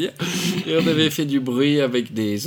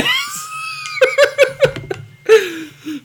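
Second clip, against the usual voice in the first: fou rire aigu; 5 dB louder than usual. Real, not acted. Fou rire après l'anecdote.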